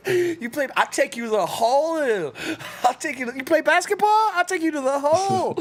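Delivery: loud, high-pitched mock boast; comic high yelled impression; hammed-up quote. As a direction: squeal it out high, imitating a character. Quoting a comic video line.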